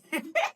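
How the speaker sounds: high, bright burst of laughter. Real, not acted. Laugh after scolding.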